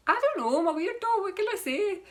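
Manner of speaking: very high, silly put-on voice; playful high-pitched squeak of mock apology; self-mocking weirdo. Jokingly owning up to being weird.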